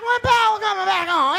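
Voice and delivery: high, loud mock fan question. Fan begging the comedian for Power news, high and loud. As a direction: squeal it like an eager fan.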